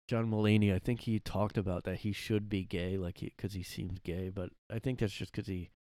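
normal talking voice

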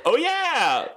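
high, loud oh yeah; dopey drunk realization. Retold or imitated. Drunk girl realizing she is in Miami, high and loud. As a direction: play dumb and high-pitched.

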